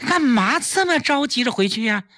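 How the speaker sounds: high-pitched, puzzled question; surprised, high-voiced objection. A narrator's reading. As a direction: protest a guest's early leaving. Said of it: "季嫣然不解其意".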